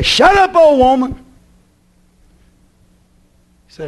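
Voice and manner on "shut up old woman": higher, snapped mock rebuke; mock sharp rebuke. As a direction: bark it at the old woman within, mock angry.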